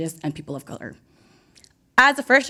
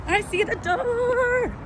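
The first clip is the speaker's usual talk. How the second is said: excited; 10 semitones higher, slower than usual. Real, not acted. Rushing in, excited.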